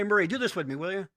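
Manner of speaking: ordinary delivery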